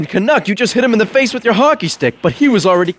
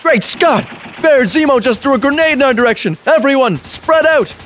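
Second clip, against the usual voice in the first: alarmed hero call. Acted. Captain America warning everyone about the grenade, raised alarm. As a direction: cry out the warning to the troops.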